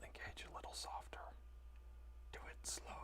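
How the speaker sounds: reading it out in a whisper. Real, not acted. Streamer whispering chat messages while holding still. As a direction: barely voice it, just breath.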